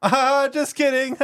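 slow, very high, loud jokey holler; cashier yelling. Retold or imitated. Cashier pranking the next customer, imitated jolly yell. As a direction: stretch it out, hammed-up and booming. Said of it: "he was, like, yelling".

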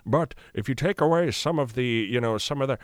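brassy British stage voice; lighter, brassier older British actor; Patrick Stewart. Shown as a demonstration. Voice actor shifts into a brassier British captain's voice. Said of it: "a working Patrick Stewart".

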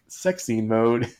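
ordinary delivery

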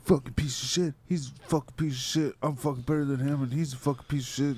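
imitated angry rant. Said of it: "he was like".

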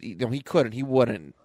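usual speaking voice

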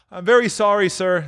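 high, meek clerk; apologetic. Acted. Clerk apologizes to his boss.

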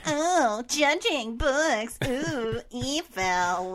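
slow, drawn-out villain voice; hammed-up sinister purr; evil voice. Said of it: "that's gonna be my evil voice".

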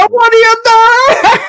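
put-on huge, very high, loud Italian accent; Roberto Benigni. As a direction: take the Italian accent up to ten. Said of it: "My favorite Italian accent of all time is Roberto Benigni".

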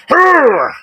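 loud high mock pirate arr; pirate growl. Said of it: "I don't know what you did that there".

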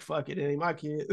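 soft quote through laughter; laughing. Retold or imitated. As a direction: laugh while giving up. Laughing while quoting Pedro.

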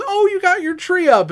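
high, pleasant mom voice; imitating mom. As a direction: lift it up like a polite mother. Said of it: "She goes".

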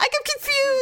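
very high, hammed-up helpless wail; panicked confusion. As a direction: play a bewildered partner under attack. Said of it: "I'm scared".

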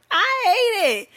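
high, squealed disgust; squeaky exaggerated complaint; exasperated squeal. Real, not acted. Comic exasperation after rant.

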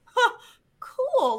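high, mock-cheerful huh, cool; a high stunned little huh. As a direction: squeak it out, caught off guard. Told the boat left them.

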